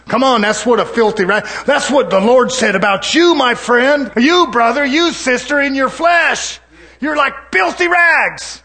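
high, shouted sermon, building to the last words; fire-and-brimstone preaching. Acted. Preacher telling brother and sister they are filthy rags, shouted preaching. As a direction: hammer it at the congregation.

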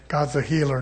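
ordinary talk

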